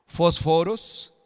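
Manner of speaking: "Fósforos" slowly, as fosFÓros; stress on second O. Shown as a demonstration. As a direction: lean on the middle syllable of "Fósforos", drawn out. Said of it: "si tiene la tilde en la segunda O". ("Fósforos" a la griega, con acento en la segunda o)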